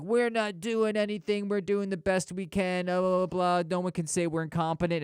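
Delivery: high, put-on protesting voice; mocking high-voiced complaint; mock defensive staff.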